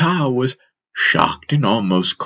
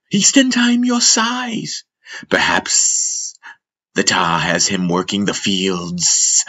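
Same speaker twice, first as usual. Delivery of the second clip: Jandi the snake; 2 semitones higher than usual. Acted. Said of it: "The master's rendition of Jandi's voice".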